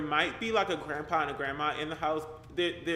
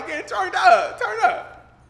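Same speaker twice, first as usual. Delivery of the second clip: much higher and louder, squealing with laughter; laughing squeal; 11 dB louder than usual. Real, not acted. Drunk guest, she laughs.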